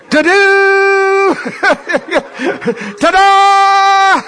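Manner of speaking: high, loud horn blast voice; shofar imitation. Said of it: "I wish I had a shofar".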